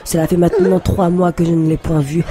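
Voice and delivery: high, loud, prim Parisian French; genteel accent to impress her fiancé; posh French accent. Acted. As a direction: put on a refined Parisian accent. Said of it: "Ton accent est parti".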